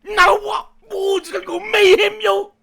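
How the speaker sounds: loud thrilled yelling; excited shout. Real, not acted. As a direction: yell with joy at the screen. Reacting to episode start.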